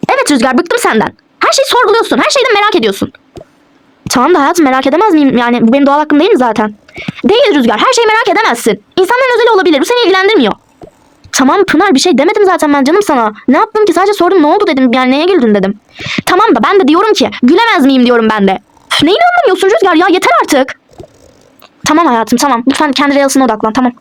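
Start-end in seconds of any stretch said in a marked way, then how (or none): 0.1-3.1 high-pitched, fed-up accusation; exasperated. Acted. Wife accusing husband of questioning everything, exasperated. 7.2-10.5 high-pitched, firm rebuke; indignant. Acted. Wife telling husband to respect privacy, indignant. 18.9-20.8 high-pitched, huffy outburst; huff, then protest in a high voice; exasperated. Acted. Wife losing patience with husband's questions, exasperated. Staged quarrel, at end of patience.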